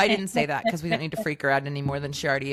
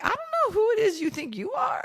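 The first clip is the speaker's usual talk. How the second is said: mother yelling at her bratty daughter, shouting; mock yell at daughter. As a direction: shout it like a fed-up mom losing it. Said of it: "Yeah, I'm like".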